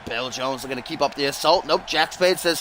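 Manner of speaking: ordinary talk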